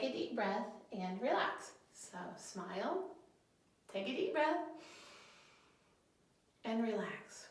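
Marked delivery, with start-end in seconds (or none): 1.9-7.5 calm, unhurried breathing instruction; guided breathing. Shown as a demonstration. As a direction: lead the breath with long pauses. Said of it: "it stands for smile".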